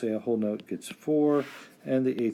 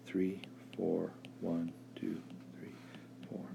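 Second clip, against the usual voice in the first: fading count; 4 semitones lower, 10 dB quieter than usual. Shown as a demonstration. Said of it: "being a beat".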